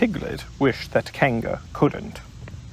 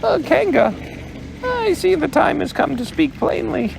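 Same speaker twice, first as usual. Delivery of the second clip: brave Piglet. Acted. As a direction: squeak it out bravely. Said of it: "in as brave a voice as he could".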